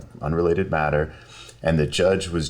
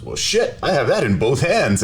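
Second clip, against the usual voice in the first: high-pitched, punchy retold exclamation; mock delighted relief; 9 semitones higher, 4 dB louder than usual. Broke client hearing it's free, imitated happy surprise.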